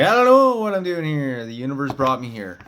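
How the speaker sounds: high and loud at first, then settling; imitating own startled excuse; flustered. Answering the upset dragon, flustered high exclamation.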